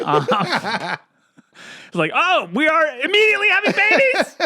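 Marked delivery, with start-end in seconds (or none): 2.1-4.4 high, loud hysterical outburst; hammed-up shrieking through laughter; mock panicked yelling. Joking about instant gremlin babies, mock-panicked yelling.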